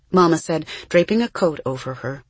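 plain reading voice

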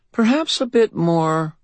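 high, slow, disdainful fey lady; fairy godmother voice. A narrator's reading. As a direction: look down your nose at him. Leah's voice.

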